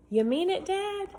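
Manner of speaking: Dad jumps way up in delight; thrilled disbelief; delighted kid. Acted. Boy asking his dad, high and overjoyed. Alex thrilled at treehouse offer.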